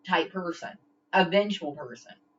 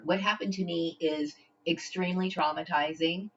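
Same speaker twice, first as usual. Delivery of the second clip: usual speaking voice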